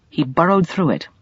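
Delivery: ordinary delivery, nothing marked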